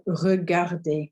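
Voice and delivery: regarder said slowly, French r, ending with an E sound; careful French word. Shown as a demonstration. Teacher models regarder slowly for learners, careful French. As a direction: stretch out regarder, the final er said as E. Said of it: "we don't pronounce it with this r sound".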